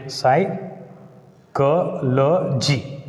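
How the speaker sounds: slow "psychology", paused after psy, cho and lo; drawn-out beats, one per syllable. Shown as a demonstration. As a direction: sound out psy, cho, lo, gy separately. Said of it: "I put stress on C H".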